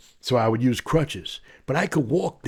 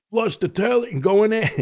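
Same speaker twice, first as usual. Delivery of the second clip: ordinary talk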